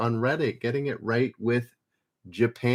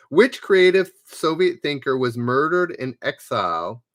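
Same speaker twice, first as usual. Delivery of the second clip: louder, announcing quiz question; quiz host read; 5 dB louder than usual. Acted. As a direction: read the question out to the players like a quizmaster. Reads quiz question aloud.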